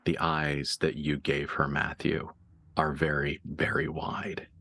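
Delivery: low, hushed narration with long pauses; game master reveal. Acted. GM reveals Elizabeth can see it too, hushed narration.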